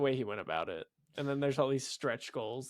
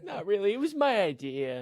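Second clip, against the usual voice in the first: high, louder mock dismissal; Stan Lee; 9 semitones higher, 5 dB louder, slower than usual. Mocking Stan Lee claiming the idea, high dismissive voice. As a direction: imitate him brushing it off, pitched up. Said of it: "And Stan Lee's like".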